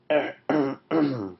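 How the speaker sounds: acted clearing of the throat. Lion tries to roar at Crocodile, clearing his throat. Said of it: "cleared his throat".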